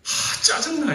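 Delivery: high, loud sigh of annoyance; annoyed exclamation. Retold or imitated. Preacher repeating his own outburst while meditating on the text, high and annoyed. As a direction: blurt it out fed up. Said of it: "이랬어요".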